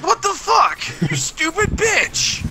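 high, slow mock yell at phone; retold yell. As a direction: shriek it in fake outrage. Retold yelling at Siri.

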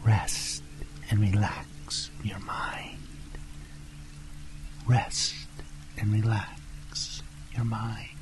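slow, soft whisper with pauses; hushed hypnotic repetition; whispered mantra. Acted. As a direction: whisper it soothingly to a relaxing listener. Repeated relaxation mantra.

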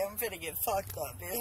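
normal talking voice

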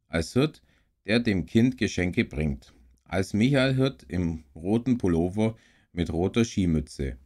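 clearly projected read-aloud storytelling with lively dialogue; story narration. Acted. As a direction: read a children's story aloud in measured phrases with pauses. Liest eine Weihnachtsgeschichte vor. (lebhaft vorgelesene Kindergeschichte)

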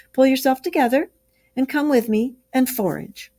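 high, rousing urging; rally a gloomy friend; encouraging. Acted. Rat rallies Mole.

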